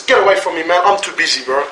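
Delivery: a careless son waving his father away; dismissive grown child. Pretend. Preacher voicing a grown child rejecting his parent, low and dismissive. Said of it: "Your child turns around and says".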